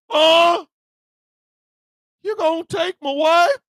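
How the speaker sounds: high, loud shocked outcry; shocked husband. Acted. Husband realising agents are taking his wife, high and loud. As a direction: gasp it out in disbelief.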